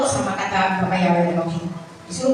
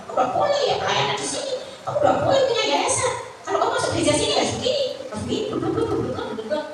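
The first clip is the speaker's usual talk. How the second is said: higher, fast, mocking imitation of the pastor; 13 semitones higher, faster than usual. Preacher mimicking the old pastor laying down church rules, high and mocking. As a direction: rattle off his rules in a high, silly, faster voice ending in blub blub.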